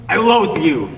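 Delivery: high, loud hammed-up disgust; over-the-top stage hatred; mock loathing. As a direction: spit out the loathing theatrically.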